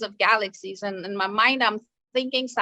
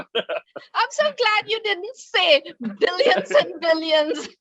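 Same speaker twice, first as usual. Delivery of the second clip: emotional laughing; 6 semitones higher, 4 dB louder, slower than usual. Real, not acted. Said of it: "You make me emotional".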